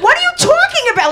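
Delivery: high, loud, rapid disbelieving squawk; mother freaking out. Retold or imitated. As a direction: imitate an astonished mother, fast and piercingly high. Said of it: "Like, everyone freaked out".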